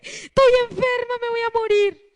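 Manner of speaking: high, loud hammed-up despair; mock surrender. Preacher imitating a believer who gives in to illness, high mock wail. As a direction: wail it out, mocking someone giving up. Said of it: "para que digas".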